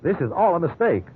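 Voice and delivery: man insisting he meant no harm; desperate plea. Acted. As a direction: beg them to listen, voice climbing. Suspect pleads innocence.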